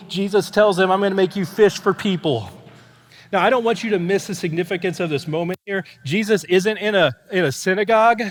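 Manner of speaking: loud, high, urgent preaching; full-force preaching. Real, not acted. Pastor to congregation about the call of the fishermen, loud preaching. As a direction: drive the passage home to the crowd.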